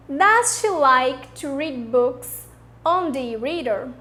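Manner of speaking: slow, clear question for students to repeat; slow model sentence. Shown as a demonstration. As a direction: read it slowly so the class can echo. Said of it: "depois eu vou dar o tempo e vocês repetem".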